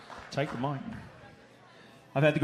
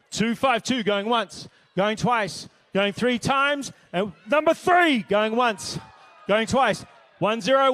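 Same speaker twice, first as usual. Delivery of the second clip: auctioneer calling bids; 5 semitones higher, slower than usual. Acted.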